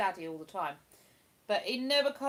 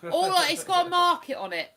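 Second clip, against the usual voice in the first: louder and higher, insisting; emphatic repeat; 8 semitones higher, 10 dB louder than usual. Real, not acted. Insisting to her partner about the street, louder emphatic repeat. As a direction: say it again with force so it lands.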